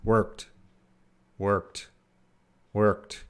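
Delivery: "Worked" said softly with the final T barely released; teacher showing a reduced T in worked. Shown as a demonstration. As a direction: let the T of worked almost vanish. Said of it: "T might be very reduced".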